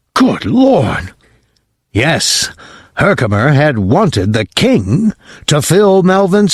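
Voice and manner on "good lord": shocked, odd-sounding father; horrified gasp. Acted. Father seeing the bloody stocking, horrified. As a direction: breathe it out in horror.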